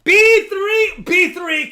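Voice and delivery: excited shouting announcer; loud hype shout. Real, not acted. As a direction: yell it out like a winner reveal. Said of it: "I've always been loud and crazy".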